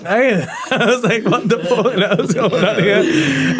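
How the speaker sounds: can barely get the words out for laughing. Real, not acted. Laughing while retelling.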